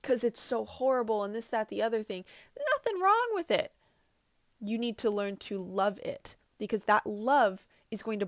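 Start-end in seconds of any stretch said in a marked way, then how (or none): 2.7-3.7 squeaky high sing-song reassurance; high reassuring voice. Acted. Mocking her own reassurance to viewers, high and sweet.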